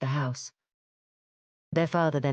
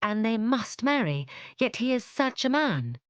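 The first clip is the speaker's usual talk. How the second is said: dismayed; 8 semitones higher than usual. Acted. Daughter dismayed.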